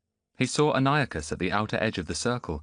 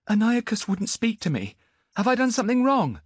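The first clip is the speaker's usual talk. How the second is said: put-on much higher, louder boy's voice; young boy; 5 dB louder than usual. Boy to Antiochus, high bewildered voice. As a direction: sound lost and bewildered.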